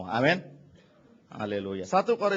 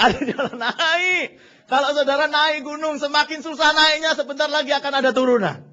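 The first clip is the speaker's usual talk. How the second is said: loud, high preaching climax; rising sermon climax; preaching at full force; 10 semitones higher, 7 dB louder than usual. Acted. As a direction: preach it up the mountain.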